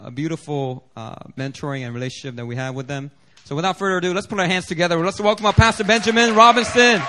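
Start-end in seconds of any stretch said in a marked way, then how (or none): 3.6-7.0 calling out welcome. Real, not acted. Introducing guest to crowd.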